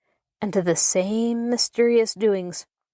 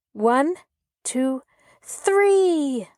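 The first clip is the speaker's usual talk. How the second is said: counting up to a shout; 6 semitones higher, much slower than usual. A narrator's reading. Laura counting the children off, excited call. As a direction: build the count to a big higher three. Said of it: "Laura commanded".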